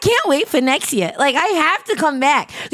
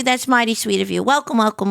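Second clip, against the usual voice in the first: normal talking voice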